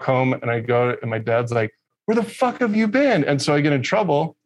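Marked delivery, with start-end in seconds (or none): 2.1-3.2 high mocking dad voice demanding answers; mock angry dad. Father scolding his muddy son back home, mock angry. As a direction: ham up the father's scolding, pitched way up.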